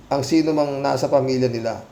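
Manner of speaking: ordinary delivery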